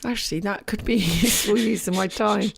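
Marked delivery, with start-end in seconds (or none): none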